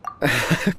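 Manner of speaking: short sheepish laugh; embarrassed chuckle. Acted. Embarrassed by the teasing.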